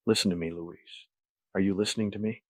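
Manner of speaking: soft warning under the breath; whispered threat. A narrator's reading. Patrick gripping Luis's collar, soft threat under breath. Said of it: "I whisper, under my breath".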